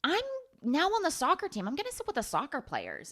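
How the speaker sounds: high, then quick mock teen voice; mock Chloe. Chloe choosing the soccer team, in a high mock voice. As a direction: squeak it out like a bubbly teen. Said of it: "And then Chloe is like".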